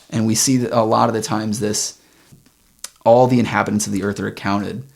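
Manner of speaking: ordinary talk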